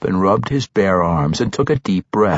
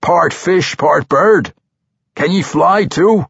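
mocking dwarf; 9 semitones higher, 5 dB louder than usual. Bruner mocking Regis.

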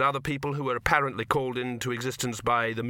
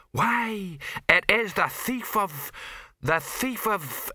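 mock heroine; 9 semitones higher, much slower than usual. Troll imitates heroine's line.

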